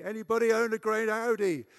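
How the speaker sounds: high, very loud shout. Retold or imitated. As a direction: call it out at full volume to a crowd. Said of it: "I shouted it occasionally".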